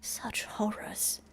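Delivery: mild mock horror; lightly voiced horrified ally. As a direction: recoil quietly at the sight. Voicing NPC dialogue.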